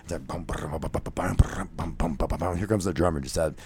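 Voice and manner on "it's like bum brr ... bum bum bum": quiet whispered drum pattern; hushed vocal drums; soft mouth drumming. Retold or imitated. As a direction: tap out the groove softly. Imitating drum groove.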